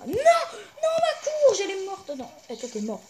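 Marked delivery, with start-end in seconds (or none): 0.1-1.6 high, loud, drawn-out wail of protest; anguished yell. Real, not acted. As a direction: cry out loudly over a sudden loss. His squash plant dies.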